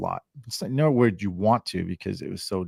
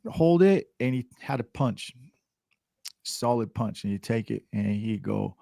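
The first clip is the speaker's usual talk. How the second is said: ordinary delivery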